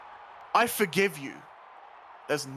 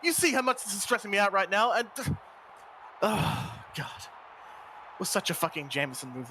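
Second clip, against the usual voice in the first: ordinary delivery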